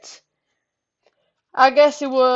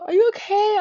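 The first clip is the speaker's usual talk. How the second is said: much higher, imitating the worried cousin; concerned cousin; 12 semitones higher than usual. Cousin checking on the narrator, voiced high and worried. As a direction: mimic her concern in a high voice.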